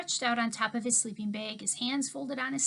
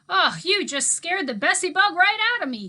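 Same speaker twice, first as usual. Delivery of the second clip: put-on startled old man; 9 semitones higher, 10 dB louder than usual. A narrator's reading. Mookie startled awake.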